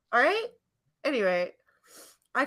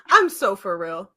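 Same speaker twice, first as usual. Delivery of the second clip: louder, emphatic for real; half-laughing loud insistence; 9 dB louder than usual. Real, not acted. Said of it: "loud insistence".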